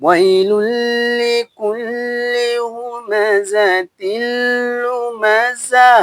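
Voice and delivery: slow, high melodic chant, vowels stretched long; teacher chanting the full verse for students to follow; Quran recitation. Shown as a demonstration. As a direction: sing the whole verse out like a reciter. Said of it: "সম্পূর্ণ আয়াত যদি আমি বলি এরকম ভাবে খেয়াল করুন".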